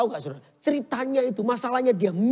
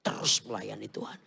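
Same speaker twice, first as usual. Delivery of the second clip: soft preaching; 10 semitones lower, 5 dB quieter than usual. Acted. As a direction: draw them in gently. (khotbah lembut dan pelan)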